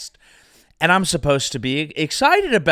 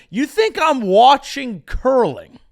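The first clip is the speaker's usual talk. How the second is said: higher and louder, mock outrage; louder incredulous question; 7 semitones higher, 6 dB louder than usual. Shown as a demonstration. Host challenges the audience about curling, loud and indignant. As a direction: demand it of the crowd. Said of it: "I've said it twice".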